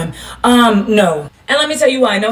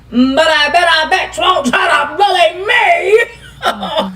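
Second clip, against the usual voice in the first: slow taunting drawl; 7 semitones higher, much slower than usual. Acted. Laughing slow taunt to people calling her a bully. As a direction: drag every word out slowly, laughing. Repeats threat, drawn out, laughing.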